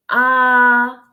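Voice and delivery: AH held flat and straight on a steady note; first tone, flat. Shown as a demonstration. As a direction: hold AH long and level, like singing one note. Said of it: "the first tone is".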